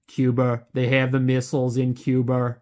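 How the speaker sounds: imitation Kennedy-style Boston accent; JFK accent. Showing listeners the fading New England accent, JFK accent. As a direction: put on a Mayor Quimby-like Kennedy accent. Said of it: "like, the J F K accent".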